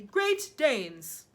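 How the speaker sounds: loud giant exclamation; triumphant cry. A narrator's reading. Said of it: "the giant cried triumphantly".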